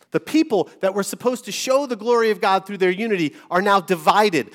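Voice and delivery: ordinary talk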